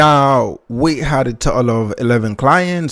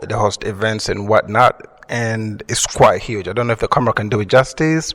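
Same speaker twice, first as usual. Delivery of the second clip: ordinary talk, nothing marked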